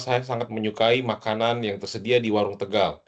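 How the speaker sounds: ordinary talk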